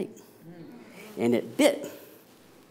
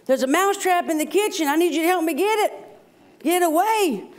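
much higher squeaky mouse voice; pleading mouse; 14 semitones higher than usual. Acted. Mouse begging the cow for help, high squeaky plea. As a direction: squeak it out fast and desperate. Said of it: "And he says".

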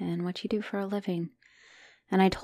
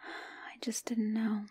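soft, slow, near-silent whisper; broken whisper; 11 dB quieter, slower than usual. Acted. Partner confessing she had no answer, slow broken whisper. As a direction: let it collapse into a whisper.

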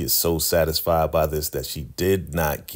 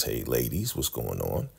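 lower, softer mock drowsy greeting; low groggy voice; 6 semitones lower, 7 dB quieter than usual. [name] rolls over to the ladies, in a low, soft groggy voice. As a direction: mumble a sleepy hello.

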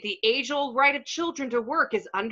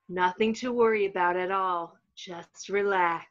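soothing hypnotic; 4 semitones lower than usual. Acted. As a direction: coax him into calm like a spa tape. Brainwashing video soothing voice.

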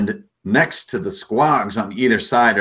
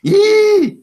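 excited squeal; 7 dB louder than usual. Acted. Goblin cheers, nobody names it.

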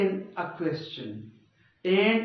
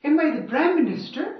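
high, loud and fast in disbelief; indignant, don't ask me; exasperated rhetorical question; 8 semitones higher, 6 dB louder, faster than usual. Shown as a demonstration. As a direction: scoff at the absurd question. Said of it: "it is called a rhetorical question".

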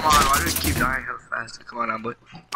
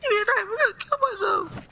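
high squeaky voice. Acted. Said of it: "Ricky, don't say it".

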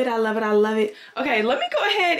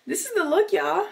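high, excited reveal; bright excited showcase of the look; showing off; 10 semitones higher than usual. Real, not acted. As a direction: present the outfit proudly. Showing off her airport outfit.

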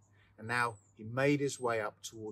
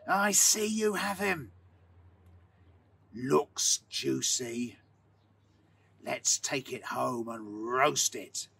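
whispered; 3 dB louder, much slower than usual. A narrator's reading. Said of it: "the miller whispered in the darkness".

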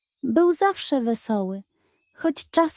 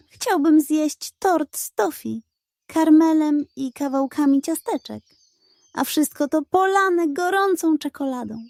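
dreamy wish; 6 semitones higher than usual. Acted. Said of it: "Zamknął oczy i szepnął".

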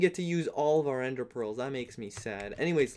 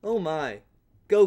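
normal talking voice

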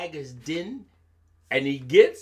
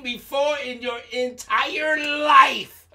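long, stretched words, higher and louder; drawn-out emphasis; 7 semitones higher, 7 dB louder than usual. Shown as a demonstration. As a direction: drag out longer to show how long. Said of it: "longer than long".

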